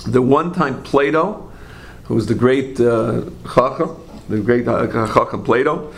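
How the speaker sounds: ordinary delivery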